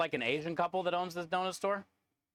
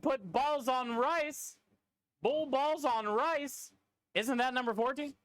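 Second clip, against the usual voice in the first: higher and very slow, drawn-out mock accent; mocking Mahler's accent; 9 semitones higher, slower than usual. Teasing Mahler by imitating his question, slow high mock accent. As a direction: stretch "Bull balls on rice" out slowly in a high teasing mock accent. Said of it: "And then Mahler said".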